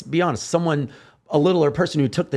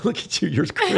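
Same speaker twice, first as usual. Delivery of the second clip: higher, laughing through the words; laughing tease; 8 semitones higher than usual. Real, not acted. Speaker interrupted mid-point, teasing through laughter. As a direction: break off into a real laugh.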